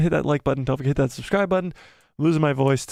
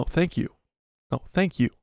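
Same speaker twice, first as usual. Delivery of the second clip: ordinary talk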